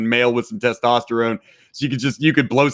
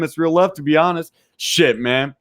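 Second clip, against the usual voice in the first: ordinary delivery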